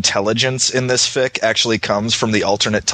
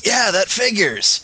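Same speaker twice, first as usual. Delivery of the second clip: drawn-out, higher-pitched "of course"; knowing mock reaction; 9 semitones higher than usual. Strangers hearing speaker is from Massachusetts, mock knowing. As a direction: imitate a stranger's knowing response, slowly.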